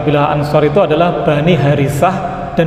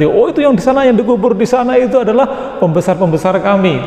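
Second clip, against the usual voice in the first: higher-pitched boastful retort; boasting rival clansman; 5 semitones higher than usual. Acted. Rival clan pointing to its buried leaders, boastful. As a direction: answer the boast in a raised pitch.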